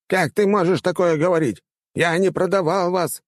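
high-pitched protesting rector; exclaiming old man. A narrator's reading. Old rector denying he sold his students, exclaiming. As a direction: cry out in self-defence. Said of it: "воскликнул старик".

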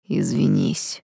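low-pitched, menacing hiss; hissed threat. A narrator's reading. Tom demands an apology from the gentleman, hissing. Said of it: "прошипел Том".

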